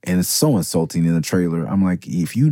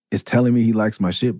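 ordinary delivery

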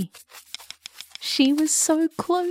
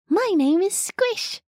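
high, cheery little bug voice; bright and friendly little caterpillar; 7 semitones higher than usual. Acted. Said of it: "a cheery little caterpillar ... said".

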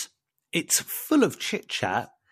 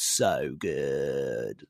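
savouring; 13 semitones lower, 5 dB quieter than usual. Acted. Savouring food, low and slow.

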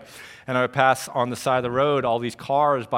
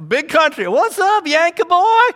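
put-on deep Southern accent; 11 dB louder than usual. Gangly Southern friend greets his Yankee pal, exaggerated Southern holler. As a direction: call out loud and high like a good old Southern boy. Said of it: "deepest Southern accent you've ever heard".

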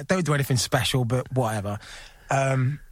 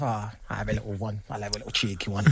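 sheepish confession; 7 semitones lower, 8 dB quieter, faster than usual. Real, not acted. Confessing the cheeky drink.

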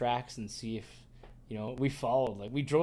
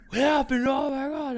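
much higher and louder, breathless cousin; excited cousin; 13 semitones higher, 10 dB louder than usual. Retold or imitated. Cousin running up after the gunshot, high loud excitement. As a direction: burst in like someone sprinting up thrilled. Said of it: "He's like".

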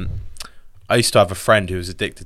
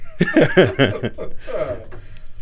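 laughing; 4 semitones higher than usual. Real, not acted. Laughing at a joke.

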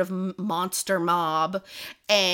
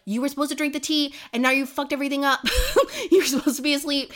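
higher, fast mock outburst; retold angry accusation; 7 semitones higher, 4 dB louder, much faster than usual. Fiance berates her for staying awake, mock angry. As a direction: rush out the accusation, pitched up.

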